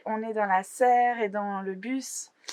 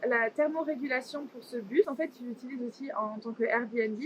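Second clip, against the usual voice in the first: ordinary talk, nothing marked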